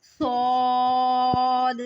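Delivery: सौद held long, thick and whistling; heavy hissing saad. Shown as a demonstration. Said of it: "दाल को last में हम हिलाएंगे". (सौद मोटा और सीटी के साथ)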